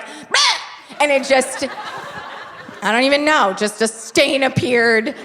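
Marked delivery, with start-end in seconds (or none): 0.0-1.0 mock disgusted bleh. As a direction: blurt a silly groan of disgust. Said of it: "I was like".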